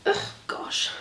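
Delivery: frustrated whisper; whispered groan. Real, not acted. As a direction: mutter it under breath.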